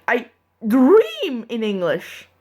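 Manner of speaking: ordinary delivery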